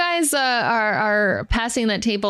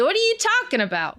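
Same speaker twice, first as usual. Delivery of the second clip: high, quick puzzled retort; can't see what the fuss is about; baffled town crier; 9 semitones higher, faster than usual. Acted. Crier answers the disgusted party, high quick baffled voice.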